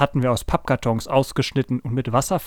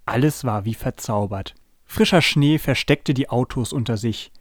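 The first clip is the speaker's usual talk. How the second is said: usual speaking voice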